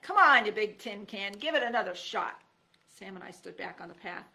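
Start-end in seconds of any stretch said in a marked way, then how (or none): 0.0-2.4 yelled taunt. A narrator's reading.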